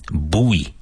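American "Buoy" said as "boo-ee"; "Buoy" in American English, "boo-ee". Shown as a demonstration. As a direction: give "Buoy" the American two-syllable "boo-ee". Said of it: "in American English, it's pronounced buoy".

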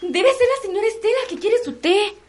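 high-pitched nervous guess; startled, flustered. Acted. Startled maid, mistress calls. (sobresaltada y nerviosa)

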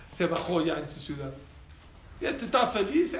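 usual speaking voice

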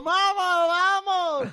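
high, loud call of vamos; lone balcony cheer. Retold or imitated. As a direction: cheer on the empty square, hammed-up. Imitating a woman cheering alone.

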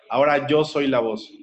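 normal talking voice

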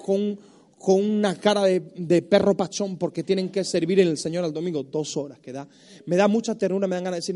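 ordinary delivery

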